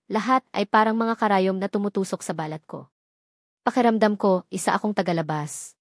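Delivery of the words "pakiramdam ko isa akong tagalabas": ordinary delivery, nothing marked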